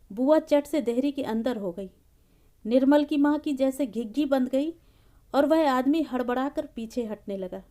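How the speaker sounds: plain reading voice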